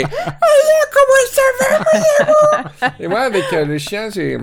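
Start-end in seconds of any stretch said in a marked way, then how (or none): 0.0-2.9 hammed-up high, loud pet talk; a dignified lawyer gone silly; mock baby talk. Lawyer doting on his dog, high loud mock baby talk. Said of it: "devant ton chien, c'est".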